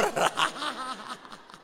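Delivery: bursting laugh at own joke; laughter. Real, not acted. (tawa lepas)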